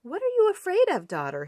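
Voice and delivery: high, quick worried king; concerned king. Acted. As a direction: ask your frightened daughter. King asks daughter.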